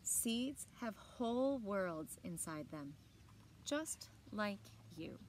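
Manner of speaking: put-on reading a picture book aloud to children; bright, clear read-aloud voice; story narration. A narrator's reading. Teacher reading a book to the class, in a storytelling voice.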